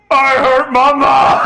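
slow loud crying wail; crying baby wail. Acted. Giant baby wailing after hurting his mom, slow loud crying. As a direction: wail it like a guilty toddler. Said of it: "replacement baby pulls her back and goes".

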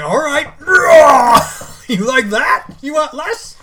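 high, loud teasing masseuse voice; mock masseuse. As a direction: taunt like a rough masseuse. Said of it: "And then she's like".

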